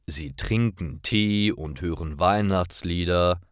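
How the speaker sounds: ordinary talk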